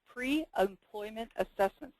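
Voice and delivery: pre-employment assessments, said slowly and deliberately; slow down and get pre-employment assessments right; carefully enunciating pre-employment assessments after a stumble; careful slow redo. Real, not acted. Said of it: "There we go".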